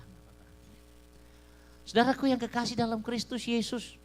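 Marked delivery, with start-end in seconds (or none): 1.8-4.0 high, loud call to the church; preaching call. Acted. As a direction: lift the greeting up to the hall.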